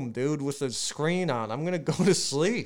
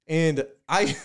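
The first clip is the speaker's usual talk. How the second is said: ordinary talk, nothing marked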